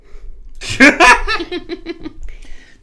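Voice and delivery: loud, high laughing fit; long high-pitched laugh; bursting laugh. Real, not acted. Laughs at the diesel phrase.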